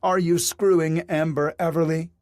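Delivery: wife accusing her husband of an affair; demanding. A narrator's reading. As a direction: confront him point-blank. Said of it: "Rebecca demanded".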